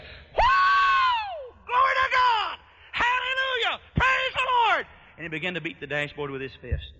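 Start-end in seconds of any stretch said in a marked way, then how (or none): 0.0-4.9 very high, loud revival shouting; imitated shouting worshipper at full pitch; ecstatic hollering. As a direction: let it all go in shrieked praise. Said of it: "while he was hollering".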